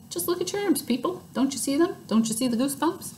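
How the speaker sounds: put-on high, insistent girly voice; insistent Lucille. A narrator's reading.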